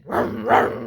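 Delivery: loud vrooming engine noise; imitated engine revving. Camper imitating the strangers' truck, engine revving. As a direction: rev it loudly with your mouth. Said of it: "they rev the engine".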